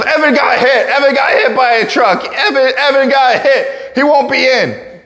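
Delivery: imitating a hysterical high-pitched bystander; hammed-up frantic phone yell; panicked yell. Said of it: "this lady just yells".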